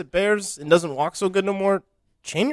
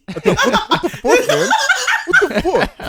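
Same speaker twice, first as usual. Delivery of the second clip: a much higher, louder mock-outraged bear, laughing through it; 5 dB louder than usual. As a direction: squawk like a bear betrayed by its picnic deal. Voices the stunned bear.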